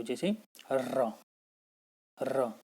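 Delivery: "र" drawn out slowly with a rolling trill; rolled r demo. Shown as a demonstration. Teacher showing students how र rolls off the tongue, slow trill. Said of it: "जैसे र का उच्चारण कर रहे हो".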